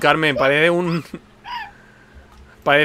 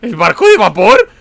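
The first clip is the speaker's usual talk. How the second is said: incredulous questioning; 5 semitones higher, 6 dB louder, faster than usual. Real, not acted. Baffled by friend's landmark.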